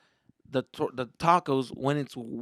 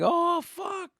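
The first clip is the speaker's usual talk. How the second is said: excited reaction. Retold or imitated.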